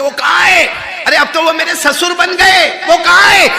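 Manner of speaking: put-on loud high yell for the old man; shouting. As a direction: call out angrily searching the house. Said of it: "چلانے لگے". (چلا کر پکارنا)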